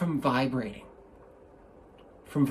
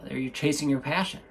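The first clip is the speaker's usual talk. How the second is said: ordinary delivery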